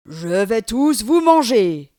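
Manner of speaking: very loud, slow demon roar; slow thundering monster threat. Acted. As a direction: boom it at the villagers. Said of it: "reprend le Djoudjou".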